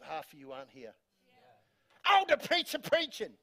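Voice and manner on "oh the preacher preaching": high, hammed-up exclamation; playful high-pitched outburst; mock preacher cry. Hammed-up cry at his own preaching.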